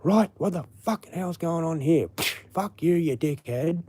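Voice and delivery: high-pitched mock-angry sailor having a go; hammed-up sailor telling someone off; burly sailor. Sailor confronting a stranger over a barking dog, mock outrage. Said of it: "burly sailor guy would have come along and just gone".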